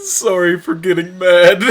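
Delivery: slow, high, loud words through laughter; laughing read. Real, not acted. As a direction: streamer laughs while reading the character's apology. Reading apology text, laughing.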